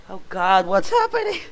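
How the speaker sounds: high, loud panicked cry. Acted. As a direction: shriek it in alarm. Panic peak.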